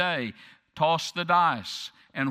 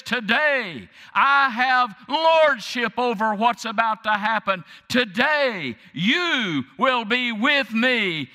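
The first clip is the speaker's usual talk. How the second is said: high, loud, ringing pulpit shout; climactic preaching; 6 semitones higher, 6 dB louder than usual. Acted. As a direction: roar the climax out to the whole church. Climactic sermon at full force.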